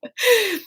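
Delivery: short high embarrassed laugh; high giggle. Real, not acted. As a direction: giggle bashfully. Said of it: "now I think I'm blushing".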